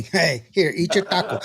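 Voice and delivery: normal talking voice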